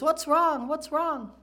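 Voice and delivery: high, loud worried call of a mother; a mother yelling up the stairs to her son; mom yelling up the stairs. Retold or imitated. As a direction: shout up to the boy, alarmed. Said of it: "his mom's yelling up the stairs".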